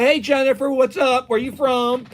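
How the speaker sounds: high, loud greeting to a new caller; party-line flirt. Retold or imitated. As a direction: play a guy pouncing on the next caller. Voices guy greeting next caller.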